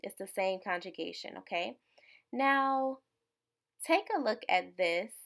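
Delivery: normal talking voice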